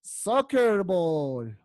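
"Soccer ball" stretched out syllable by syllable; slow model pronunciation. Shown as a demonstration. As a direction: say "Soccer ball" very slowly so they can copy. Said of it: "Fácil, ¿cierto?".

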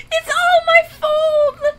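high, slow sobbing repeat; crying. Real, not acted. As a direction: sob it out, high and dragged. Panicked player blames herself.

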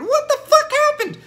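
shrieked high and loud; a hammed-up squawk of disbelief; mock viewer outrage. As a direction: blurt it out as a shocked fan. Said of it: "I know what you're thinking".

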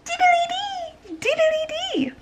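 very high breathy whisper of a tiny dino; teeny whispered creature voice. Acted.

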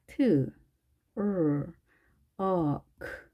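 each sound of 트럭 alone: 트, 을, 어, 크; fonemi separati. Shown as a demonstration. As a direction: sound out 트 … 을 … 어 … 크 slowly, with pauses. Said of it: "발음 기호를 표기해 보겠습니다".

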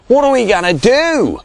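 moaned. A narrator's reading. Big Bob moaning to the other shearers, moaned. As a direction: wail it helplessly. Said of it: "moaned Big Bob".

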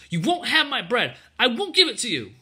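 put-on high, loud girlish defiance; defiant. A narrator's reading. Ellen locked tight against the soldier, loud defiant refusal. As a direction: refuse forcefully in a child's voice. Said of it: "she muttered".

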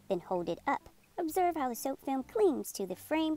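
ordinary delivery

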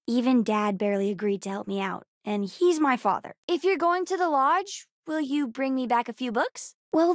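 ordinary delivery

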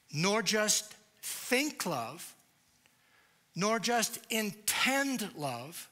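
each phrase slow and punched; slow down and press every word home; slow, emphatic preaching. Acted. Building sermon climax on love.

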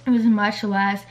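ordinary delivery

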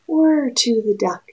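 plain reading voice

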